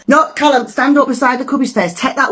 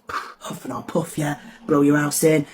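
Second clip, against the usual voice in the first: big bad wolf; 7 semitones lower, 7 dB quieter than usual. Big bad wolf threatens the little pig's house, low and menacing. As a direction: breathe the threat out low and soft.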